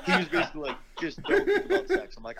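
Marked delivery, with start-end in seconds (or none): 1.0-2.1 laughing through a quote. Retold or imitated. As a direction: quote spoken through a high, loud laugh. Retelling advice while laughing.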